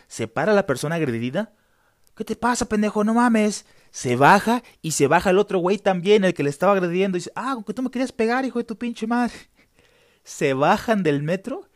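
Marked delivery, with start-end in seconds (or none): 2.1-3.7 higher and louder furious outburst; angry shout. Retold or imitated. Kicked passenger yelling at his attacker, furious and loud. 7.3-9.3 fast, higher, furious challenge; furious accusation. Retold or imitated. Angry man challenging the passenger he meant to kick, fast and furious. Attacker confronts the other man.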